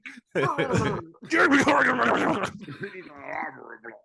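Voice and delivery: high gibbering cartoon-beast sputter; Taz impression. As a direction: burst into garbled, growly creature babble. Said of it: "I love Taz".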